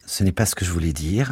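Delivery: ordinary delivery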